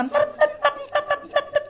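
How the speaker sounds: very high repeated staccato syllable; imitated stuck stutter. Man asking why his friend always gets stuck, imitating the stammer. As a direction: hammer one high note, mock stammer. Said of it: "כל פעם הוא נתקע ככה".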